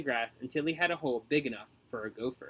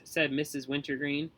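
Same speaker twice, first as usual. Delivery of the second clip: ordinary delivery, nothing marked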